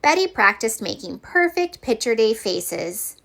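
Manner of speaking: usual speaking voice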